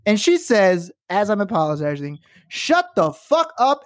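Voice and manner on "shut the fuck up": mock yell, high and drawn out; retold yelling. Lady customer cuts off the apologizing teller, mock yelling. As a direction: imitate a furious customer, slow and high-pitched. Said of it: "she starts yelling at me".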